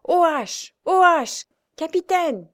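pale, shaken doctor crying out; shouting. A narrator's reading. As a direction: cry out the order in a panic. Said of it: "criait à son tour".

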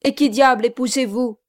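loud, fast, indignant; indignant demand. Acted. Gentleman confronting the groom, loud and indignant. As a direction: fire the question at him.

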